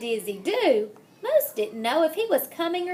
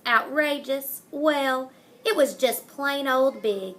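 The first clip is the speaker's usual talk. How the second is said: ordinary delivery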